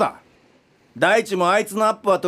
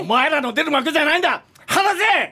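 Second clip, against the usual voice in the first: high, rising shout; raging yell, higher at 離せ; angry yell; 7 semitones higher than usual. Acted. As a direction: shake them off. Director restrained, struggling.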